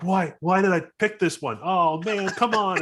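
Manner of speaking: high-pitched frustrated pleading; exasperated pilot. Acted. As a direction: complain at the stubborn controls. Voices pilot flipping failing switches.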